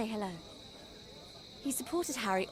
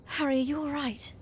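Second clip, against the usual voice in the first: ordinary delivery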